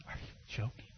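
breathy disbelieving whisper; whispered aside. Retold or imitated. Pastor overhearing plotting campers, whispered disbelief. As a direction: mutter it under your breath in disbelief.

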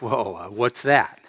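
low, slow, hesitant question; taken aback. Retold or imitated. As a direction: act out being thrown, dropping the voice and slowing down. Taken aback by retold criticism.

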